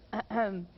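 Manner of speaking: short put-on throat clear; deliberate little "ahem"; demonstrative throat clearing. Shown as a demonstration. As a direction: clear the throat to show the habit. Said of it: "fazer o famoso pigarrear".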